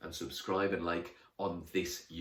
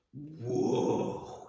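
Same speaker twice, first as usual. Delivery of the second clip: awed whoa; 7 semitones higher than usual. Acted. Amazed at the large figure.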